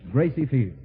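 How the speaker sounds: ordinary delivery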